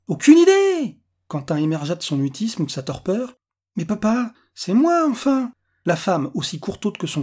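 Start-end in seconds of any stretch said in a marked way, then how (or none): 0.1-1.0 loud, high-pitched outburst; exasperated shout. A narrator's reading. Répond vivement au policier. 3.8-5.5 put-on high-pitched child pleading with Dad; protesting little boy. A narrator's reading. L'enfant supplie son père.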